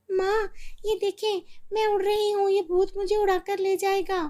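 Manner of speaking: put-on girl floating away, screaming for her mother; panicked girl. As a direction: cry for help. Girl still flying.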